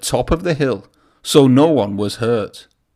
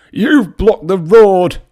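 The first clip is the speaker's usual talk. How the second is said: louder, higher, slow angry snap; 7 semitones higher, 8 dB louder, slower than usual. A narrator's reading. Bertie snapping at the blocking trucks, loud and cross. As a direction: bite the words out crossly. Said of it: "he snapped".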